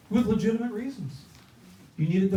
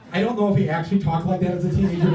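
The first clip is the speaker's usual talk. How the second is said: ordinary delivery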